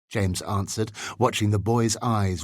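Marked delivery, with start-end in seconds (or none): none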